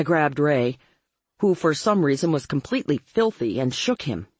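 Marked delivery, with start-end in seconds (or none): none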